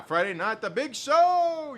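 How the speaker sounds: big hyped announcer call. Pretend. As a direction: boom it out like a show host. Announcing Friday night show.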